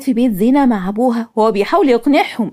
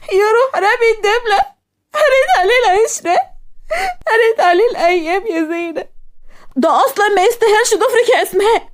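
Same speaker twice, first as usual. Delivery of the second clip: tearful consoling; 9 semitones higher than usual. Acted. Consoling crying Asmaa, tearful pitch.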